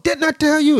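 high-pitched mock scolding; shrill-pitched retold telling-off; scolding mom. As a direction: imitate a mother screaming at her son. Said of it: "she cursing and screaming".

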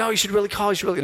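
high, insistent mom voice; mom's eager urging. Retold or imitated. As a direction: push him like an excited mom. Mom urging him to call.

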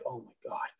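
very soft, low acted shock; stunned murmur. Driver reacts to gun going off, stunned and hushed. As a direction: drop right down to a stunned undertone.

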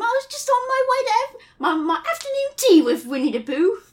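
high-pitched English schoolboy; little British boy accent. Acted. Christopher Robin greets Piglet in a little British boy accent. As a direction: announce yourself as a small British boy. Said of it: "your little British boy accent".

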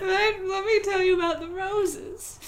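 high, laughing through the plea; laughing role voice. Pretend. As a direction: break up laughing mid-character. Keeps playing the pleading searcher.

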